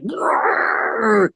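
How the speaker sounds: guitar growl. Retold or imitated. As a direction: growl like a roaring amp. Imitating guitar blasting.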